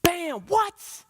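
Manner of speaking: hammed-up disbelief; mock shock. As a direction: act stunned by the sudden hit. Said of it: "And you're like".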